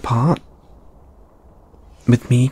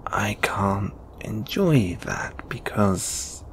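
soft, low grumble to yourself; half-whispered private grumbling; sulky muttering; 4 semitones lower, 10 dB quieter than usual. Acted. Sulking to self, unaware of listener.

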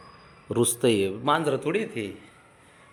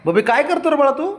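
much higher, louder fond call; 13 semitones higher, 5 dB louder than usual. Real, not acted. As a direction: coo at the pet. Calling pet affectionately. (लाडिक हाक)

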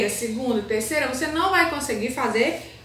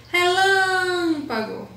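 Relâmpago with LÂM held long and high, as if singing; sing-song stress demo; 7 semitones higher, much slower than usual. Shown as a demonstration. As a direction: chant out the strong syllable of Relâmpago, slowly. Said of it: "como se você estivesse cantando". (Relâmpago cantado, com o LÂM bem esticado)

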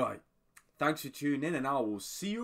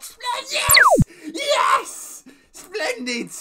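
very high, loud delighted shriek; ecstatic outburst; 16 dB louder, much slower than usual. Retold or imitated. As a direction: shriek it like a thrilled fan. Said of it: "I was like".

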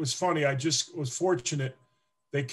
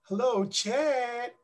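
high, slow, sweet woman's voice; imitating a woman caller; 7 semitones higher, much slower than usual. Player imitating the Packers secretary, high sweet voice. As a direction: put on a gentle lady caller. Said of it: "the girl goes".

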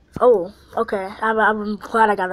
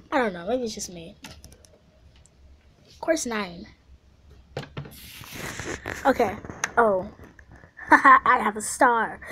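ordinary delivery

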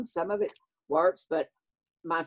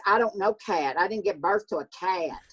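higher, huffy Southern mama; Southern mother; 4 semitones higher than usual. Acted. Mother rejecting her daughter's nickname Kat, higher Southern huff. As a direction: play the fancy mother correcting a nickname. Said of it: "She goes".

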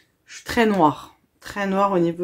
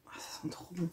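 soft breathless whisper; hushed pleasure while sniffing; whispered delight; 2 semitones lower, 20 dB quieter than usual. Real, not acted. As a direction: whisper it as if savouring the smell.

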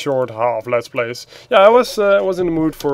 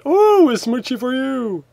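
ordinary talk, nothing marked

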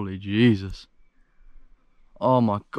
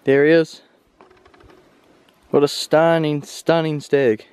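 loud, thrilled cry on finding the stag; real excitement, called out loud; excited; 3 semitones higher, 6 dB louder than usual. Real, not acted. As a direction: burst out with joy at the sight. Hunter reaches his downed stag.

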